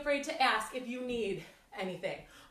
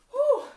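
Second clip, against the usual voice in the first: high, laughing exhale; breathless whew; 13 semitones higher, 9 dB louder than usual. Real, not acted. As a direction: blow out the effort with a laugh. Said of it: "I'm out of breath".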